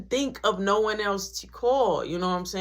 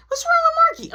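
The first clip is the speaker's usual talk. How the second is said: put-on much higher, louder kid asking; kid brother voice; 8 dB louder, faster than usual. Little brother asking about Marky, high kid voice. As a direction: pipe up like a curious little kid.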